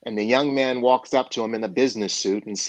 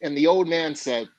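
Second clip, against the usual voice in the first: ordinary delivery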